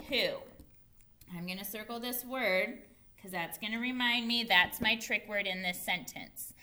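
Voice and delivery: ordinary talk, nothing marked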